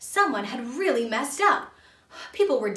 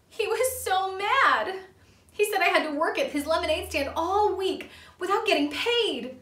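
high, hammed-up wail; sobbing; 10 semitones higher, 4 dB louder than usual. A narrator's reading. Junior complaining about the unpaid lemonade stand, mock-sobbing. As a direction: whine it high and loud, mock-tearful. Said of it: "Junior sobbed".

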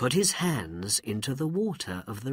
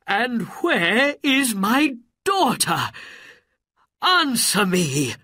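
loud, high, furious demand; angry cry; 11 semitones higher, 7 dB louder than usual. A narrator's reading. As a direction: shout it as an enraged ruler demanding answers. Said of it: "he cried angrily".

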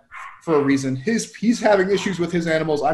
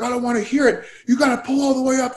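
imitated irate stranger, raised pitch; mock bananas rant; 6 semitones higher than usual. Stranger refuses the driver's explanation, imitated ranting. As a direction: rant high without shouting, as the stranger. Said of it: "he's just going bananas".

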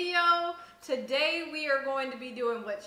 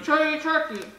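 cheeks stuffed full; 5 semitones higher, 7 dB louder than usual. Real, not acted. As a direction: mouth full of marshmallows. Mouth filling with marshmallows.

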